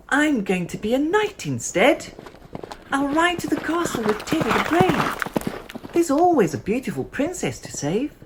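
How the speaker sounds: put-on very high, loud child voice, slowing at the dragon; a little girl boldly playing knight; brave little knight. A narrator's reading. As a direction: heroic child voice, polite to the dragon.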